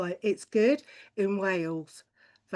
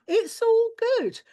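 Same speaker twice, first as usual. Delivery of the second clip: much higher and louder, squeaked out; squeaky put-on voice; 14 semitones higher, 6 dB louder than usual. Comic mimicking chirpy Welsh neighbours, high squeak. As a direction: squeal it in a silly falsetto.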